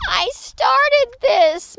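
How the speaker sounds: high, loud, drawn-out moan; little creature's guilty moan. A narrator's reading. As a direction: wail it slowly in dismay. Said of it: "moaned Pip Squeak".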